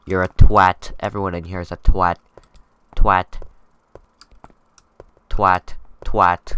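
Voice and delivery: loud, punchy insults; shouted insult. Pretend. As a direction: bark each word out. Insulting inmates for fun.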